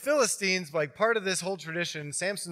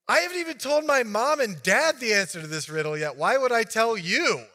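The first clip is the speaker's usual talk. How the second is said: higher, louder indignant retort; indignant Samson; 6 semitones higher, 6 dB louder than usual. Retold or imitated. Samson refusing his fiance the answer, mock indignant retort. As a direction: brush her off, defensive.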